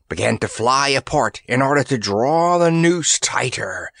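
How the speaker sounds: usual speaking voice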